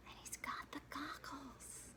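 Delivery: slow, soft whisper; whispered aside. A narrator's reading. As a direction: breathe it like a secret. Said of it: "whispered aside".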